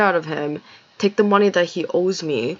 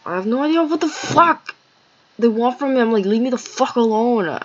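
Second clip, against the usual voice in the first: high-pitched, fed-up venting; exasperated outburst; 6 semitones higher, 4 dB louder than usual. Real, not acted. Venting about people who won't leave her alone, exasperated. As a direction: let the frustration push the voice up high.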